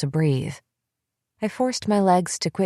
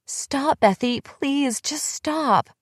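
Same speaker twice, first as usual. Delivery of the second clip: much higher, broken tearful begging; sobbing plea; 9 semitones higher, much slower than usual. Acted. Emily, crying on the couch, begging Bethy to stop. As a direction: beg her to stop through tears. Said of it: "She was sobbing now".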